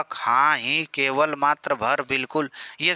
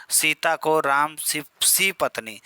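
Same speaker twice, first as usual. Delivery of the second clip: slow, सी drawn out and falling; stress on सी; slower than usual. Shown as a demonstration. Teacher slowly showing emphasis, सी stressed. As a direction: dwell on सी. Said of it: "अब देखो सी बल दे रहा है".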